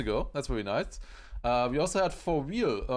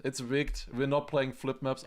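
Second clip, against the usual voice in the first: usual speaking voice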